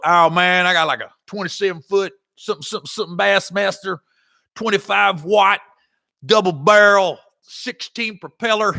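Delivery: mock twangy brag, rushing the list; bragging country buddy. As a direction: rattle off nonsense boat specs like a proud country boy. Brad bragging about his boat.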